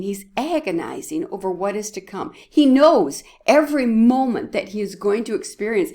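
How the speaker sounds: normal talking voice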